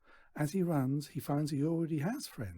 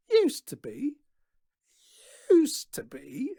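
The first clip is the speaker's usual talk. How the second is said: very high and loud, slower on the repeat; indignant outrage; 12 semitones higher, 10 dB louder, slower than usual. A narrator's reading. Daughter offended by her father's remark, high loud indignant. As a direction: fling it back, affronted. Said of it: "echoes Natasha".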